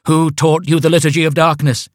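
high, booming cult chant; grand cult leader. Acted. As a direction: intone like a vain cult leader. Cult call and response.

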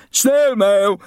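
high, loud bratty shout. Acted. Bully shouting a prank through the old man's letterbox, gleeful yell.

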